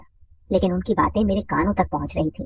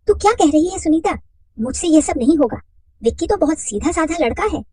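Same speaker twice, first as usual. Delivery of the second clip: higher, protesting friend; startled woman's voice; 9 semitones higher, 3 dB louder than usual. Acted. Radha protesting to her friend, higher. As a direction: pitch it up in protest.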